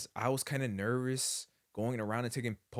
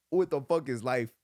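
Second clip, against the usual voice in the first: shocked; 3 semitones higher, 6 dB louder than usual. Retold or imitated. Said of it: "in shock".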